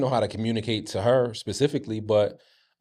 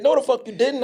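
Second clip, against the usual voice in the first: imitated mom; 13 semitones higher, 3 dB louder than usual. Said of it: "She said".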